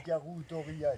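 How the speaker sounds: whispered like a ghost story; breathy scary whisper; spooky whisper. Pretend.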